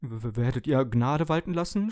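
frightened kettle stammering the first word; stuttering. Acted. Kettle begs its owner for mercy, stuttering. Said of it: "stotterte der Wasserkocher".

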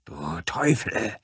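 whispery, voiceless cry; strained whispered exclamation; exclaimed curse. A narrator's reading. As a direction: gasp the curse out in a harsh whisper. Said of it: "rief die Blonde".

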